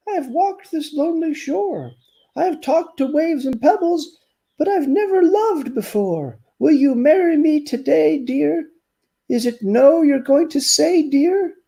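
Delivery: a weary turtle pleading tenderly; storybook turtle wooing a bagpipe; lovesick turtle. Acted. Turtle proposes.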